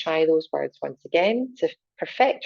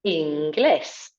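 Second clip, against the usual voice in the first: inglés with the last syllable raised, in-GLES; stress on the e. Shown as a demonstration. Teacher modelling inglés, stress on the é. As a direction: lift the é in inglés. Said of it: "Accent up on the E".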